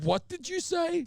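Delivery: high, slow put-on cop voice; mock trooper voice. Trooper asks him to repeat, high and slow. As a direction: ham up the baffled cop. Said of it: "He goes".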